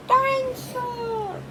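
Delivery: put-on high, slow toddler cry of dinosaur; toddler wail. George crying for his lost toy, high slow wail. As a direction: wail like a little boy.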